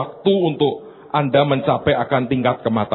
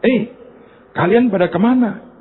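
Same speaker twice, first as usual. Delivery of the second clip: gossipy lady voice; 8 semitones higher than usual. Retold or imitated. Gossiping church ladies voiced.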